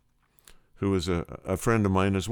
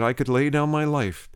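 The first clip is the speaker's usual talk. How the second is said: retold fervent testimony, voice raised in pitch; impassioned vow; 6 semitones higher than usual. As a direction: declare it earnestly, pitched higher. Vow repeated at the meeting.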